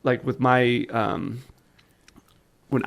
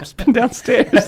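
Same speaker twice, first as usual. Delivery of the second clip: laughing while quoting; 11 semitones higher, slower than usual. Retold or imitated. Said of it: "She's like".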